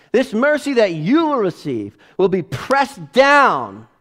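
loud, high fiery preaching; preaching at full force. Acted. Pastor driving home his biggest point to the congregation, fiery preaching.

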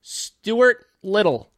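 high, slow, stretched name; drawn-out high announce. Pretend. Voicing the title Stuart Little, high and slow.

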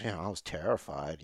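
low, quiet, throwaway admission; offhand understatement. Retold or imitated. Guest guesses Seth's tone of voice, offhand and quiet. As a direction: imitate a casual, downplayed confession. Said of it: "what tone of voice, like, Seth used".

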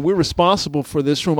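normal talking voice

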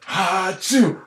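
hammed-up pretend achoo; fake sneeze. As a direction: sneeze it out theatrically. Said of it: "The pretend sneeze and cough".

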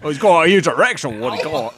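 hammed-up British heckle; broad fake British accent; shitty British accent. Host twisting the presenter's line, mock British. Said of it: "activate shitty British accents right now".

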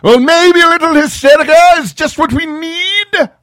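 high, loud shouted threat; manic villain shout. Acted. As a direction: shout it like a madman. Villain declares plan.

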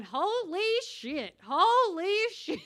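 slow, loud, high drawn-out exclamation; mock stunned dad, drawing it out; dad in the background. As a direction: imitate dad calling out in shock, stretching each word. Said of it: "hear my dad in the background going".

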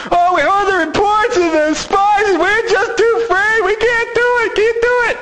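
high, whining, fearful complaint; whiny and scared. Acted. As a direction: whimper it like frightened people. Israelites' fearful complaint to Moses.

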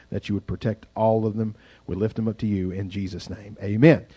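spoken prayer. Real, not acted. Pastor praying aloud for responders as sirens pass. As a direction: pray gently for the responders.

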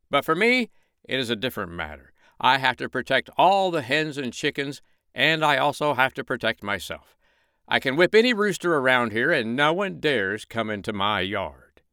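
high, loud boastful rooster; crowing proud rooster. Acted. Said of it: "said the rooster".

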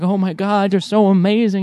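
high, loud fan gush; teenage self gushing at the lead singer; starstruck gushing. Retold or imitated. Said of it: "I was like".

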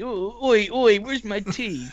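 put-on high, slow posh English accent calling for tea; imitating Dean's English accent. Mock posh English gent calling for his tea. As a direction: lord of the manor English accent demanding tea. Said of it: "that's my imitation of Dean's accent".

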